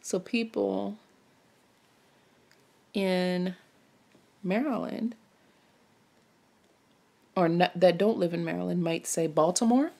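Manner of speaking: ordinary talk, nothing marked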